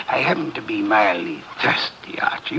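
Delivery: hushed, half-whispered admission; sheepish whisper. Acted. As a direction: confess it almost under the breath, a little embarrassed. Sheepishly admitting he wants beer.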